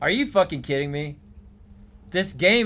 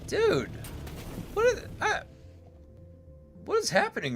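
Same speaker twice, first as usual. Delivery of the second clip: surprised; 6 dB quieter than usual. Real, not acted. Shocked at game glitch.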